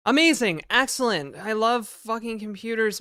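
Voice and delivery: loud, bitter sarcastic praise. Real, not acted. Streamer after losing his cart, loud sarcastic praise. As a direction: fake cheer through real frustration.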